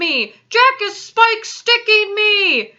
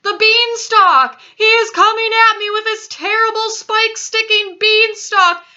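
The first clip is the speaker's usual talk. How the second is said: high, loud wailing plea; screamed begging; 12 semitones higher, 9 dB louder than usual. A narrator's reading. Giant fleeing Jack's beanstalk in a dream, screamed begging. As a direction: scream and wail for mercy, stretching key words. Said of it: "screamed the flesh lump eater".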